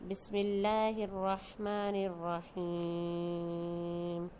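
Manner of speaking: slow, measured chanted prayer; Quranic recitation. Acted. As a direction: recite the dua slowly and reverently. Bismillah recited before lesson. (آہستہ، ترتیل کے ساتھ دعا کی تلاوت)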